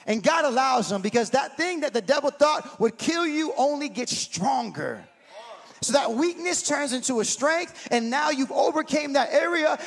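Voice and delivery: loud, exclaiming church preacher; fired-up preaching. Real, not acted. Pastor rallying the church against the devil, fired-up preaching. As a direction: preach it with rising fervor and punched words.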